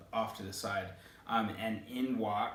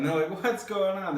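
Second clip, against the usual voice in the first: much higher and louder, bewildered; confused high yelp; 8 semitones higher, 6 dB louder than usual. Acted. Crowd of crossover characters panicking, bewildered high yelp. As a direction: cry out in total confusion.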